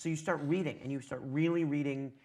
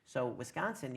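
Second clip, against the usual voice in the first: usual speaking voice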